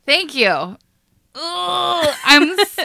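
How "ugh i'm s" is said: high, loud flustered groan; flustered and breathless; worked up. Real, not acted. Worked up after story.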